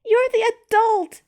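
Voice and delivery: high, slow, loud indignant screech. A narrator's reading. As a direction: screech it, stomping her foot. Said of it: "Zena screeched".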